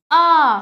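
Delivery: आ said loud with the mouth wide open; a long open आ, held; drawn out aa. Shown as a demonstration. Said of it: "इसका उच्चारण करते समय हमारा मुख पूरा खुलना चाहिए".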